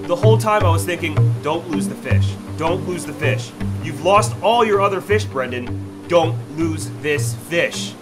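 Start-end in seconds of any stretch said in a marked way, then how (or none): none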